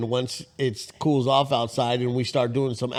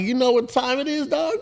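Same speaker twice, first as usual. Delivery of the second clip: pitched-up teasing greeting; high teasing call; 11 semitones higher than usual. Retold or imitated. Teasing the neighbor caught out at dawn, high playful. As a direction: needle him playfully, high.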